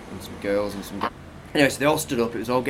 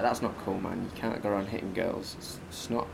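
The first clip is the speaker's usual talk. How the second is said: muttered rebuke; 5 semitones lower, 9 dB quieter than usual. Retold or imitated. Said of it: "I was a bit pissed off at him 'cause I was like".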